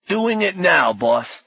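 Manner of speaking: high-starting raspy Brooklyn voice; obliging sidekick with a Brooklyn rasp; raspy Brooklyn reply to the boss; raspy Brooklyn accent. A narrator's reading. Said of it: "said in a raspy Brooklyn accent".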